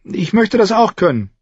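put-on high, eager girl's demand; blurted out. A narrator's reading. Girl demanding to learn the trick, blurted eagerly. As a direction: burst out with it in a high voice. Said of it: "platzte sie heraus".

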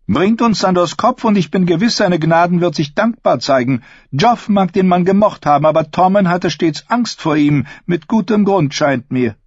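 high, cold, haughty queen; evil queen. Acted. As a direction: sneer down at him as queen. Cersei voiced in reading.

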